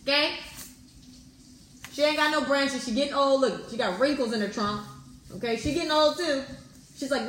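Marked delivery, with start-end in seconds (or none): none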